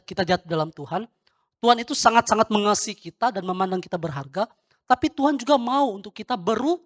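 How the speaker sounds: loud, urgent sermon delivery; emphatic preaching. Real, not acted. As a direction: drive the teaching home to the congregation. Berkhotbah menegaskan ajaran. (berkhotbah lantang dan tegas)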